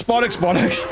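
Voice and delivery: ordinary talk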